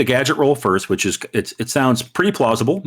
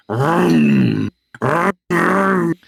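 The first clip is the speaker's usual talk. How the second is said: engine noise; 6 semitones higher, much slower than usual. Retold or imitated. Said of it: "the driver like".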